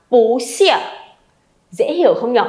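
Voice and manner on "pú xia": "pú xia": rising pú, falling xia; changed tone of pu. Shown as a demonstration. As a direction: lift "pú", then drop "xia". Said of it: "chúng mình phải đọc là".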